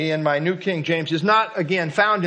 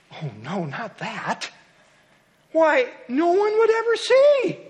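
hasty refusal; 7 semitones higher than usual. Acted. The poem's worker refusing God's task, hastily. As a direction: blurt out the excuse, then pitch it up. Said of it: "I answered quickly".

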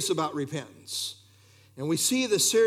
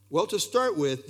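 ordinary talk

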